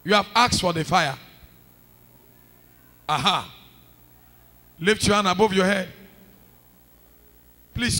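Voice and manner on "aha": ordinary talk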